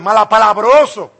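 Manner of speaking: ordinary delivery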